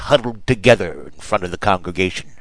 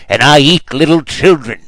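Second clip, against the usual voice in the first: higher and louder, a monster's threat; mock yell; 5 semitones higher, 6 dB louder than usual. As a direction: yell it to scare the children. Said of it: "I yelled".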